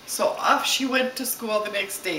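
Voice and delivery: ordinary delivery, nothing marked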